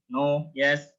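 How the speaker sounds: normal talking voice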